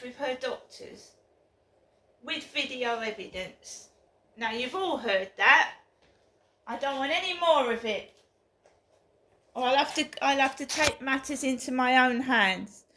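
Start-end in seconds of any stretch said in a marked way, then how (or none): 4.4-12.8 loud angry warning. Real, not acted. Warning viewers, angry rant.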